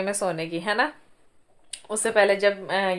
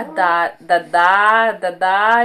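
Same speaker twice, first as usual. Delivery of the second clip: loud imitation of a baby calling dada; mother imitating her baby shouting dada; 8 dB louder, much slower than usual. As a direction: copy the baby's first word, calling out. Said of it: "जोर जोर से चिल्ला रही थी".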